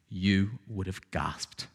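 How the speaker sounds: soft, slow, low; hushed wonder. Shown as a demonstration. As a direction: let it fall away in awe. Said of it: "repeated phrase, soft then raised".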